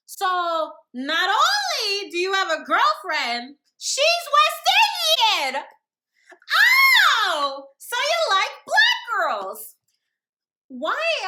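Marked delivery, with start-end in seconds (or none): 0.1-9.8 louder, higher, slow outrage; indignant. Real, not acted. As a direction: exclaim it in outrage. Indignant rant.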